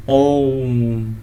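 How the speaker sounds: ओम held very long and slow; drawn-out om. Shown as a demonstration. Teacher demonstrating the prolonged vowel to students, very slow and held. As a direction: pull ओम out as long as the breath allows. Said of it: "बहुत ज्यादा समय लग रहा है".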